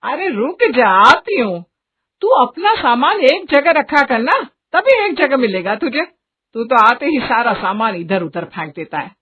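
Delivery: mother calling out and nagging; scolding mother. Acted. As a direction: scold your untidy son. Narrator plays the scolding mother.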